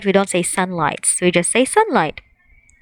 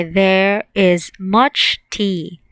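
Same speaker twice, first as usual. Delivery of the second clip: slow model sentences; slower than usual. Shown as a demonstration. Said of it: "Or we can say".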